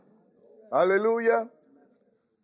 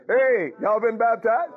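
higher, louder, slow demand; loud slow call-out; 7 semitones higher, slower than usual. Real, not acted. Preacher challenging the congregation, loud and slow. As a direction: call it out to the whole room. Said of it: "this is why I talk this way".

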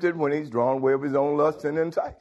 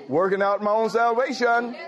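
preaching at full force; 3 semitones higher than usual. Acted.

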